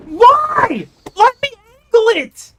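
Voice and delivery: very high, loud anguished shout; real tilted scream; raging cry. Real, not acted. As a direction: scream at the game in despair. Raging at game.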